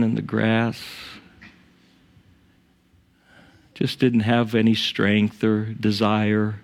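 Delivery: unhurried prayer with long pauses; measured, pause-filled pastoral prayer. Real, not acted. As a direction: pray reverently, leaving silences between phrases. Leads the congregation in prayer.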